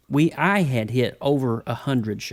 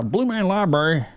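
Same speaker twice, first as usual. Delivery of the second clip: mock Southern drawl, pitched up; 4 semitones higher than usual. Shown as a demonstration. Host naming the old Blue Man Library days, Southern drawl. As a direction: lean into a playful Southern drawl. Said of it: "I say that with a Southern drawl".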